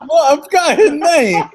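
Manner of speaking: sudden high, loud protest; defensive shout. Real, not acted. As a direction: blurt it out in self-defence. Caught out, defensive.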